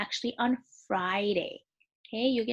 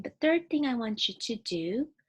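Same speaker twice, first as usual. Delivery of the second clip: normal talking voice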